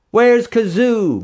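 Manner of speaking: high, loud, slow demand. A narrator's reading. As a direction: shout it at him. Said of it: "more people demanded".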